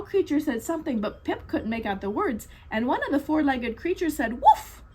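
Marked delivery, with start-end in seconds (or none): 4.4-4.8 high, loud playful woof; mock puppy bark with a laugh; dog bark. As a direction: bark like a happy sled dog. Dog barks in story.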